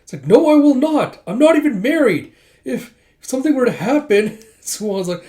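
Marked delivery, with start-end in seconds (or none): 0.3-4.4 high, loud panicked protest; flustered outburst. Retold or imitated. Embarrassed at sharing a room, flustered shrieking protest. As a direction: squeal out the refusal.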